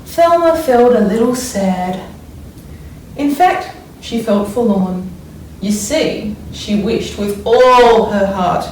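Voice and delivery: put-on expressive read-aloud for children; story reading. A narrator's reading. Teacher reads picture book aloud.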